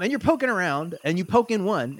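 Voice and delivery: usual speaking voice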